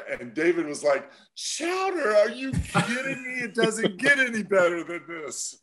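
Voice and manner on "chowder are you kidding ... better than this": high-pitched mock thrill, easing down toward the end; excited imitation. Retelling David's thrill at being offered chowder, excited imitation. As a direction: squeal it like an overjoyed colleague. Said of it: "how excited he gets".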